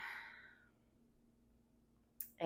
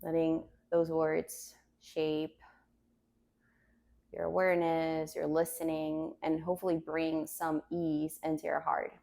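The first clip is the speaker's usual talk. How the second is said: calm grounding exercise. Acted. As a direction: guide them gently to the mat. Yoga teacher guides meditation.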